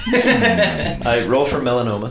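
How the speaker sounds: really laughing through the words. Real, not acted. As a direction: crack up while saying it. Table cracking up over skin cancer joke.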